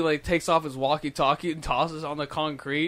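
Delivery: ordinary talk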